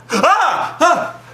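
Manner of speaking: high cries of alarm; hammed-up startled yell; mock scream. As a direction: yelp at the orchestra sting. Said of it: "he goes like".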